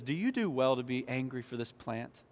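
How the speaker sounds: low, soft, measured question; quiet questioning. Retold or imitated. God to Jonah in his anger, quiet questioning. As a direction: put the question to him quietly.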